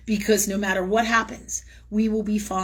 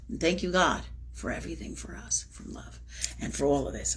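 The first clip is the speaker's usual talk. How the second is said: whispered thanks; 4 semitones lower, 7 dB quieter than usual. Real, not acted. Prayer trailing to whisper.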